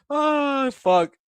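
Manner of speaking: high-pitched, laughing exhale; cracking up, voice shooting up high; real laughter. Real, not acted. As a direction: say it through the tail of a laugh. Cracking up over the Bane joke.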